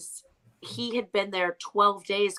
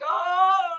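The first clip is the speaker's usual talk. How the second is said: choked up; 9 semitones higher, 6 dB louder than usual. Retold or imitated. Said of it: "I'm the one, like, breaking down".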